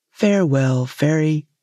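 a hint of an unbothered fisherman dismissing her; lightly played low fisherman. A narrator's reading. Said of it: "said the fisherman".

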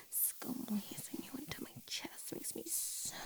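soft intimate ASMR whisper. Acted. Cuddling, ASMR whisper run.

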